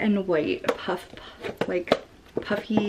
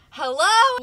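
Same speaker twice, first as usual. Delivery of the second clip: excited; 11 semitones higher, 7 dB louder than usual. Real, not acted. Cheerful vlog intro greeting.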